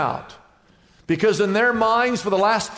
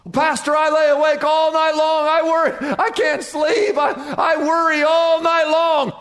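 high, louder anxious plea; sleepless worrier; 8 semitones higher, 3 dB louder than usual. Retold or imitated. Believer telling the pastor about sleepless worry, anxious. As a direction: voice a worried churchgoer pleading.

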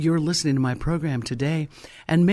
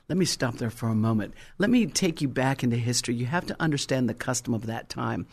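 usual speaking voice

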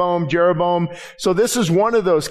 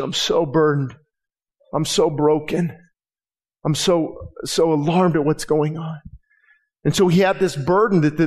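usual speaking voice

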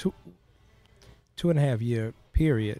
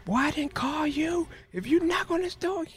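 much higher, put-on voice of a warning father; hammed-up dad warning the boy off, pitched way up; imitating father. Father warning his son through the bathroom door, much higher. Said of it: "He said".

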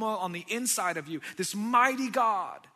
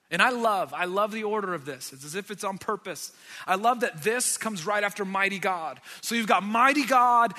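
usual speaking voice

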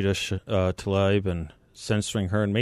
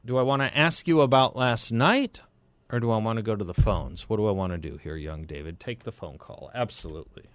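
usual speaking voice

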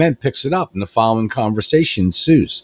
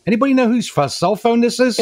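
much higher, innocent punchline voice; clueless husband punchline; 12 semitones higher than usual. Retold or imitated. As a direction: ask the room brightly, like it's nobody's phone. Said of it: "He goes".

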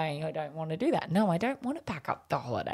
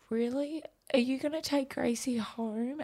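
imitating the boy Jack; 8 semitones higher, 4 dB quieter than usual. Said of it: "he goes".

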